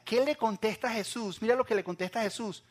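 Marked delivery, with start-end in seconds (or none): none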